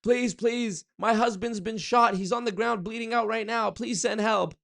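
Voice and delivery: desperate high pleading to the operator; mock panicked 911 call; panicked caller. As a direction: beg for help as if your husband was shot. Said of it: "you're like".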